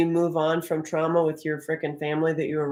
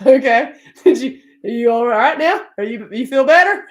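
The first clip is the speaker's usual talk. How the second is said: high, loud, through laughter; amused retold mother; laughing concern; 6 semitones higher, 9 dB louder than usual. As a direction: check in while cracking up. Retold reply, laughing, after child's outburst.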